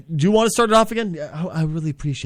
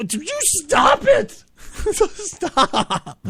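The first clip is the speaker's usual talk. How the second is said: exasperated plea; 12 semitones higher, much slower than usual. Real, not acted. Host begs co-host to stop.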